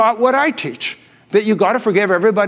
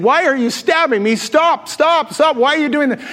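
retold screaming; 6 semitones higher than usual. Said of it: "screaming and yelling and saying".

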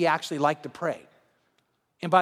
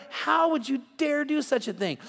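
shocked sailors; 5 semitones higher than usual. Acted. Sailors confront Jonah.

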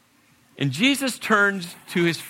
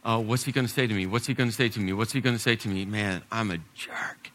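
low, hushed, racing worried self-talk; fretting quietly under the breath; anxious inner monologue; 6 semitones lower, 6 dB quieter, faster than usual. Acted. Peter's anxious thoughts voiced.

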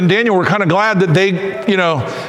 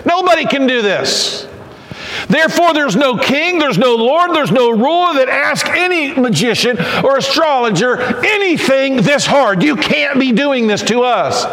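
higher, pleading voice of the frightened wise men; desperate Chaldean advisors; 7 semitones higher than usual. Acted. Advisors pleading with the king, in a high desperate voice. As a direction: beg the king that nobody can do this. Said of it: "and they said".

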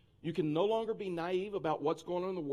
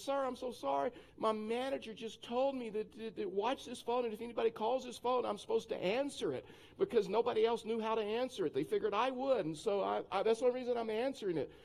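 higher-pitched flustered apology; young girl explaining; 6 semitones higher than usual. Retold or imitated. Young employee explaining to angry caller, apologetic. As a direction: imitate a girl pleading her innocence. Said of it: "she's trying to explain".

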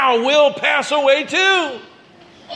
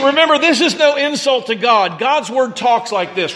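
ordinary delivery

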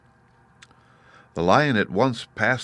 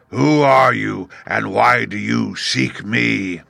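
roaring beast; 3 semitones higher, 5 dB louder, slower than usual. Acted. Beast Oz questioning the Woodman, loud and slow. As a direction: boom the question, slowly. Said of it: "one great roar".

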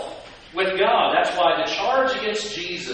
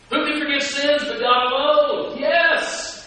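much higher, louder affirmation; excited call-out; 10 semitones higher, 5 dB louder than usual. Real, not acted. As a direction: rouse the room with the answer. Calling out to congregation.

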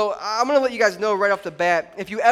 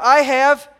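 call and response; 5 semitones higher, 9 dB louder than usual. Real, not acted. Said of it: "everyone help me out".

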